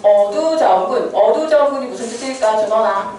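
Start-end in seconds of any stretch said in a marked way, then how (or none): 0.0-1.1 어두자움군 stretched out at half speed; sounding out slowly. Shown as a demonstration. Teacher has students read the term aloud, sounding it out slowly. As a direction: read 어두자움군 slowly, drawing out the first syllables. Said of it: "소리 내보세요".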